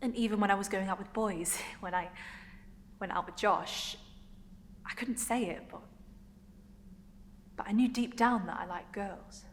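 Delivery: acted confession with long hesitant pauses; girl recalling first crush. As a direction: recall it in short, halting phrases. Performs monologue recalling first crush.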